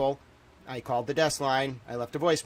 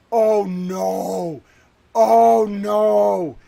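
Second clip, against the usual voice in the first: loud, higher cries of real dread; panicked; 5 semitones higher, 10 dB louder, much slower than usual. Real, not acted. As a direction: cry out in real alarm. Panicked reaction to discovery.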